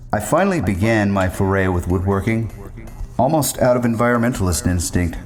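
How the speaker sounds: ordinary delivery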